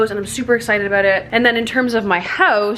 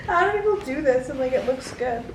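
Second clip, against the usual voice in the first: laughing; 7 semitones higher than usual. Real, not acted. Giggling over bad photo.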